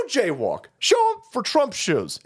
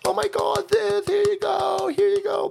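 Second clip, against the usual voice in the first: gushing fans; 12 semitones higher than usual. Retold or imitated. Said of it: "They go".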